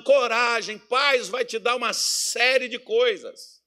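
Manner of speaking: loud, high, full-force preaching; fiery pulpit preaching. Acted. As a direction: drive the point home to the congregation.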